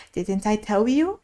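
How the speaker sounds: plain reading voice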